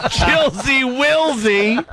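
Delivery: high, slow and laughing; laughing disbelief. Real, not acted. [name] laughing at the pet name, high and slow. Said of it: "I don't think I've ever said that".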